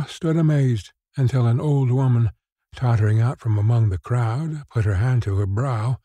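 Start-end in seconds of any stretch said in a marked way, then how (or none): none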